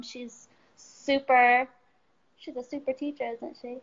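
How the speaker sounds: plain reading voice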